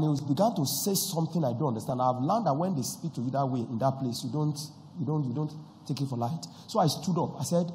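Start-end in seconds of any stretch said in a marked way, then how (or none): none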